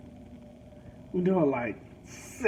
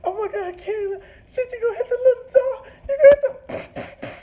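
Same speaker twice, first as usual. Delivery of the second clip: much higher and louder, half-laughing, half-crying imitation; hammed-up wife begging him to hit the dog; mock hysterical wife; 7 dB louder than usual. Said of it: "She's like".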